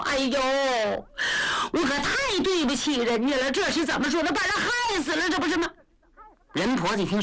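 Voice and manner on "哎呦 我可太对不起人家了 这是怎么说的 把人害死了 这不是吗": high, tearful self-reproach; a lightly sobbed wail of guilt; crying lament. Acted. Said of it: "一声就哭了". (带着哭腔的自责哭诉)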